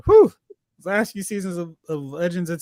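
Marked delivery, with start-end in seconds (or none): 0.1-0.3 exasperated whew, high and loud. Real, not acted. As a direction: blow it out in frustration. Exasperated sigh about the show.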